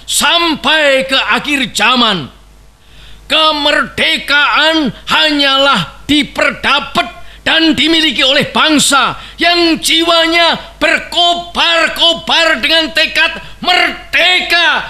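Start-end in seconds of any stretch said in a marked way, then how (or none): 3.3-14.8 rising to a higher, roaring climax; roaring rallying speech. Real, not acted. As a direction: thunder the final call to arms. Orator's climax to assembly.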